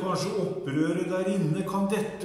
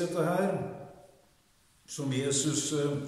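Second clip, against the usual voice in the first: ordinary talk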